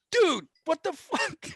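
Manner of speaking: high-pitched, drawn-out comic disbelief; mock indignant outburst. Runner-up reacting to losing to a race manager, mock indignant. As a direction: stretch it out in high, mock-outraged protest.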